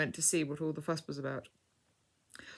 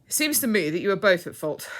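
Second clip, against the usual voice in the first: louder, firm verdict; firm judgement; 4 semitones higher, 9 dB louder than usual. A narrator's reading. Mister Discover the Truth judges the two fighters, firm. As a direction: lay down the verdict firmly. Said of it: "observed Mister Discover the Truth firmly".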